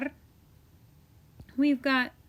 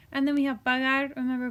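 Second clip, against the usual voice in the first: ordinary talk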